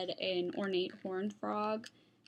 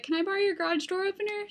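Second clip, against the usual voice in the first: quick, high sweet asking voice; Laura asking; 9 semitones higher, 3 dB louder, faster than usual. Retold or imitated. Laura asking her friend for the garage opener, quick and sweet. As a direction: wheedle for a favor, fast. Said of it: "she's like".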